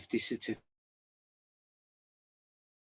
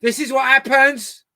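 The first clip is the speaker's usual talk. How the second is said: exasperated outburst; 9 semitones higher, 9 dB louder than usual. Real, not acted. Exasperated at dog.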